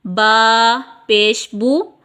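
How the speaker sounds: बा पेश बू slowly, बू with rounded lips; teacher's spelling drill; slow drilled reading. Shown as a demonstration. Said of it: "होंठों को गोल करेंगे".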